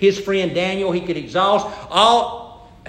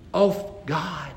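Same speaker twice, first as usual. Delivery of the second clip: ordinary talk, nothing marked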